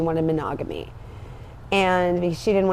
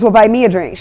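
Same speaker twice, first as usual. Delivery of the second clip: louder pushy demand; insistent demand; 10 dB louder than usual. Retold or imitated. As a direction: push him to buy the drink. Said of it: "He goes".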